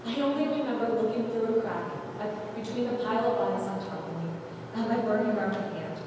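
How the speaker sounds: plain reading voice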